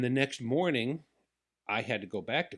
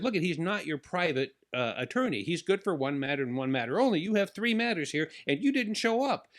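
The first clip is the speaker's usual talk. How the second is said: a retold judge scolding, raised and louder; higher, louder mock judge telling off the defendant; judge yelling; 7 semitones higher, 4 dB louder than usual. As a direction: bark it from the bench. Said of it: "the judge is yelling at me now".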